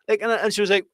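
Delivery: ordinary talk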